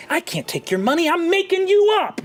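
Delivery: high, brisk carnival barker pitch; gnome showman. Acted. Gnome illusionist hawking to the market crowd, high showman voice. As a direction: hype the crowd like a cocky street magician.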